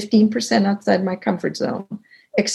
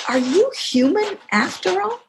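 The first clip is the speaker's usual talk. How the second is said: high, swooping incredulity; amazed question; 11 semitones higher than usual. Retold or imitated. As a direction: imitate a colleague's astonished discovery. Teammate's climactic question, retold.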